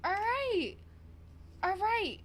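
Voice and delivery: loud, high "All right" called out; excited. Real, not acted. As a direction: burst out in delight. Excited reaction to sim.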